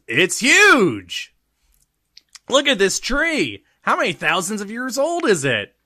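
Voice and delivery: high, loud amazed shouting; excited shout. Acted. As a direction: shout it out in wonder. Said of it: "he shouts".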